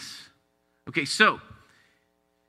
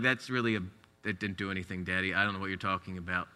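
put-on much softer, fast, unimpressed kid; kids' voice; 10 semitones lower, 11 dB quieter, faster than usual. Father voicing his kids, quiet and unimpressed. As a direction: voice the bored child quietly.

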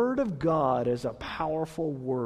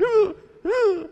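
high mock wailing of a crying toddler; imitated child crying; 14 semitones higher, 5 dB louder than usual. Pastor imitating his crying son, high mock wail. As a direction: cry out like a small son wailing. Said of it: "he's going".

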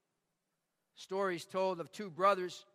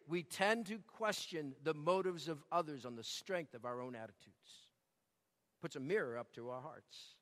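"tend" and "our own" said much softer and lower; hushed, lower reprise for the congregation to reflect; quiet repeat; 6 semitones lower, 8 dB quieter than usual. Shown as a demonstration. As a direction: drop to a low, hushed echo of the line. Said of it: "See it?".

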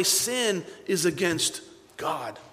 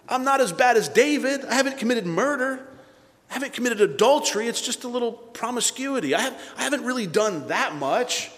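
higher-pitched mock excuse-making; self-justifying protest; 6 semitones higher than usual. Churchgoer comparing himself to David, self-justifying protest. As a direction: protest lightly that you're not so bad.

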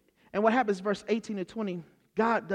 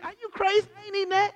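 indignant Peter; 13 semitones higher, faster than usual. Acted. Peter talking back to the Lord, high and indignant. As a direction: act out a disgusted flat refusal. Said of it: "You know what that's really saying?".

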